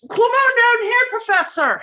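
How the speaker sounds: high, loud call upstairs. Acted. Landlady calling up the stairs to the professor, loud high call. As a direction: call him down from above.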